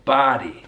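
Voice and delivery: "Body" said bah-dee; American "Body" with open ah; American pronunciation. Shown as a demonstration. Teacher echoing the clip for learners, American pronunciation. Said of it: "So that's his American English".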